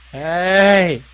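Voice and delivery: loud high repeated hey; screaming. Acted. Player re-enacting the elf's call, screaming. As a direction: scream it like that owl. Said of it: "Just screaming".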